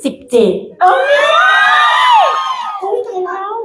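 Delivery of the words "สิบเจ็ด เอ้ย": drawn-out exclaim. Real, not acted. Host hyping the guest's weight loss, long high excited cry. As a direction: stretch it out very slow and high, amazed.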